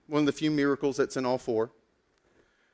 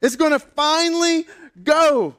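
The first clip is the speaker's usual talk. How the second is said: excited anticipation; 11 semitones higher, 7 dB louder than usual. Retold or imitated. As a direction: build up the big moment at full voice. Disciples' excited expectation of an uprising.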